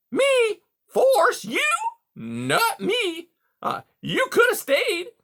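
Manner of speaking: high, loud feigned innocence; mock innocent beaver. A narrator's reading. Beaver denying blame to Rye, high mock innocence. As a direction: play wounded innocence.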